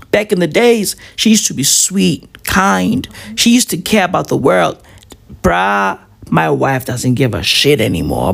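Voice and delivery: ordinary talk, nothing marked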